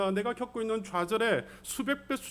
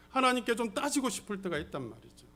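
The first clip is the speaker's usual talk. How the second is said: ordinary talk, nothing marked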